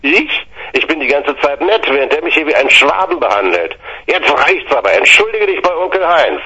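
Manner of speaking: high, heated protest; indignant outburst. Retold or imitated. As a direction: pitch up in outrage, fast. Heated family argument quoted.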